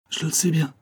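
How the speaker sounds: hachée, essoufflée, à peine audible; voix saccadée. Acted. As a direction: parle comme à bout de souffle. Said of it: "d'une voix saccadée, à peine distincte". (voix saccadée et haletante)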